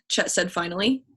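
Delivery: ordinary delivery, nothing marked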